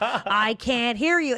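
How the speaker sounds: slow, drawn-out sneering taunt; taunting the stuttering girl. Retold or imitated. Bully Nellie taunting the crying girl, slow and sneering. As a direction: lean in and mock her, slowly. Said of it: "torturing her and ... saying".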